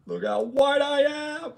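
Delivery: high, stretched-out mock cry; mock exclamation. Streamer clowning about his own skin, high mock exclamation. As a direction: draw it out high and loud.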